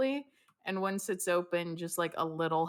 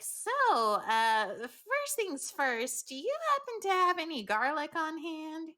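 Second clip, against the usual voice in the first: higher, slow grand lady voice; haughty lady; 9 semitones higher than usual. Acted. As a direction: ask politely with airs. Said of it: "Be like".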